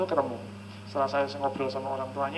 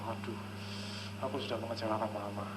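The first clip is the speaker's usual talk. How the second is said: put-on guilty child; 6 semitones lower, 11 dB quieter than usual.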